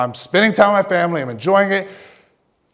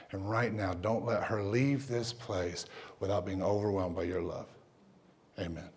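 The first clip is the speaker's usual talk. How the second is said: hushed, low, earnest prayer; praying; 7 semitones lower, 16 dB quieter than usual. Retold or imitated. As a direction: pray softly over someone in distress. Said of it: "I just start praying the gospel over her".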